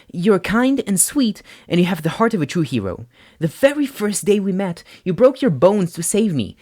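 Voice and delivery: a friend speaking from the heart; earnest Uraraka. A narrator's reading. Uraraka telling Izuku honestly what she thinks.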